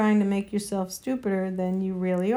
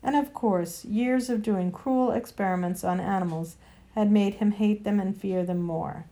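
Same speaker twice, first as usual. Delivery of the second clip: usual speaking voice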